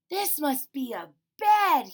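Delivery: high, loud, slow discovery; delighted high bear voice; amazed little bear. Acted. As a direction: marvel at finding a bed. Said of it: "he said".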